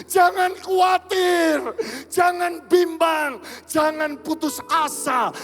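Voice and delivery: high, sustained, ringing preacher's exhortation; rally the congregation with slow, stretched commands; full-force preaching. Real, not acted. Berkhotbah penuh semangat kepada jemaat. (seruan khotbah berapi-api)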